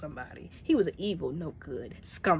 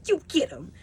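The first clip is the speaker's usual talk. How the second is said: cheering on; 11 semitones higher, 5 dB louder than usual. Retold or imitated. Cheering Drax on.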